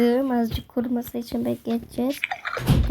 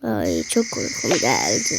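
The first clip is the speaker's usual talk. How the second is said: sleepy; 11 semitones lower, slower than usual. Real, not acted. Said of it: "çok şey uykuluyum".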